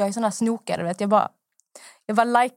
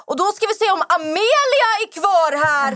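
much higher, louder host voice with a laugh; mocking Joakim Lundell; 11 semitones higher, 5 dB louder than usual. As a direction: ham up a cheery live host calling a viewer up. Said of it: "han bara".

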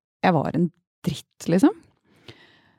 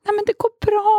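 high, gently reassuring imitation; lightly voiced kind man; mock reassuring friend; 3 dB louder than usual. Forgiving friend reassures crying woman, imitated high voice. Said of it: "Og han bare".

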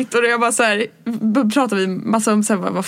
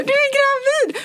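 very high, excited squeal. Retold or imitated. Friend on FaceTime guessing the pregnancy, very high squeal. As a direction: shriek it in delight. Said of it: "Och jag bara".

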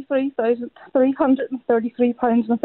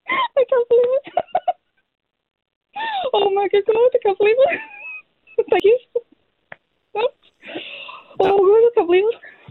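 high, breathless giggling through the words; laughing in disbelief; 9 semitones higher than usual. Real, not acted. Caller hearing she won thirty-three grand, laughing in disbelief. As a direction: laugh and gasp with shock at the win. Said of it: "That better be crying and not laughing".

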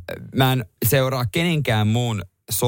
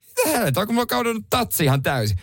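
incredulous; 9 semitones higher, faster than usual. Retold or imitated. Retelling disbelief at mechanic.

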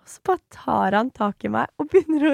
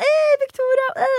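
much higher, loud cheerful call; calling her name; 4 dB louder than usual. Retold or imitated. His friends calling out to her, loud and much higher. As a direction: hail her across the street. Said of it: "de var sånn".

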